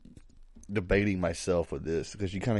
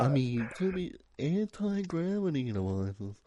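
ancient aliens guy impression; 8 semitones higher, 3 dB quieter than usual. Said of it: "you do his accent so perfect".

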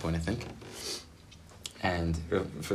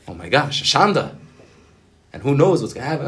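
loud, hammed-up dismay; mock alarm; 6 semitones higher, 7 dB louder than usual. Imagining the rabbi's inner reaction to scandal, mock alarm. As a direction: act out a touch of scandalized alarm, pitch jumping.

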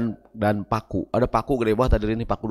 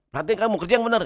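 mock stern boss; 6 semitones higher, 3 dB quieter, much faster than usual. Boss scolding.

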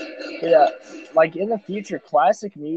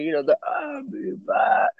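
put-on high, slow, feeble dying old man; dying words; 7 semitones higher, 3 dB quieter than usual. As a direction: croak it out like a dying elderly man. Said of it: "You sound like a dying elderly man".

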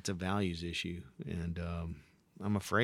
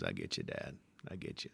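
much lower, softer murmur; soft agreement; 10 semitones lower, 12 dB quieter than usual. Retold or imitated. Son to father, low soft reply. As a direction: quietly give in to dad. Bobby answering Hank tenderly.

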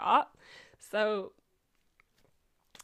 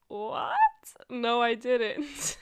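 loud, drawn-out mock disbelief; incredulous retold denial; shocked denial; 3 semitones higher, 8 dB louder, much slower than usual. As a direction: protest slowly and loudly, acting out the shock.

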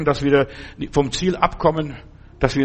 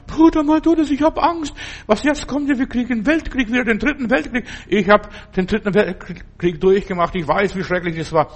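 high, agitated imitation of an anxious woman, easing toward the end; frightened church sister; 6 semitones higher than usual. Church sister phones her pastor about war, imitated high-pitched panic. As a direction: mimic a panicky caller, pitched way up, then settling. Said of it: "rief mich eine Schwester an".

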